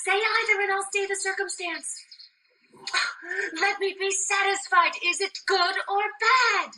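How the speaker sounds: high, urgent demand; impatient pleading. Acted. Juliet begging nurse for the news, impatient pleading. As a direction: press hard for an answer.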